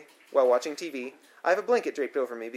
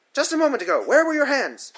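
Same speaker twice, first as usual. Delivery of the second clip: much higher, louder accusing demand; angry repeated question; 11 semitones higher, 6 dB louder than usual. A narrator's reading. Sitter pressing the boy a second time, high angry demand. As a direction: press her again, furious. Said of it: "she asks again".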